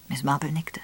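usual speaking voice

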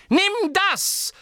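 very high battle cry; McGonagall, shouting. A narrator's reading. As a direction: fling the attack out with a cry. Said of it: "rief Professor McGonagall".

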